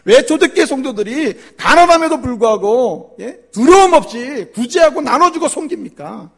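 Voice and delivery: high, loud preaching; preaching at full force. Acted. Pastor challenging the congregation, loud preaching. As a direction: press the question on the church.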